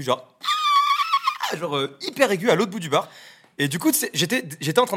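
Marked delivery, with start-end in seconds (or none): 0.4-1.5 very high shrieking scream, mimicking the girl across the bar; imitated shrill scream. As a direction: let out a piercing high cry. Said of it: "mais vraiment cri hyper aigu".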